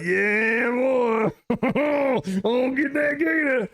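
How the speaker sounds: hammed-up Cajun accent shouting happily. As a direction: play the Cajun gator man hollering with glee. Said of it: "the deepest Cajun accent".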